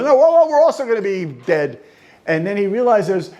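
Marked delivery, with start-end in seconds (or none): 0.0-1.8 sun and moon answering. Retold or imitated. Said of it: "he quoted the sun and the moon".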